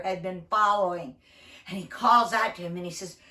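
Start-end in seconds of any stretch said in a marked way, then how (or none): none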